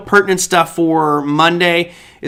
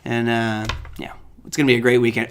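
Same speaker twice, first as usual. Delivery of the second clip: normal talking voice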